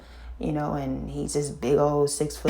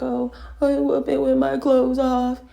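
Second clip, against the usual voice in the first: put-on crying child; 9 semitones higher, 4 dB louder than usual. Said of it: "I was crying when I said it, like, snot crying".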